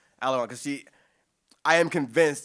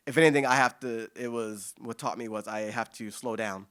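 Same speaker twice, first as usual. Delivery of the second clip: ordinary talk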